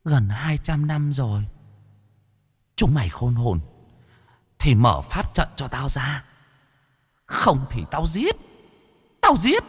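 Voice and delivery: eerie, high and thin threat; wind-like ghost voice. A narrator's reading. Female ghost demanding release from the magic circle, eerie and threatening. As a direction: start airy, climb to a high menacing cry. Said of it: "Âm thanh như gió thoảng".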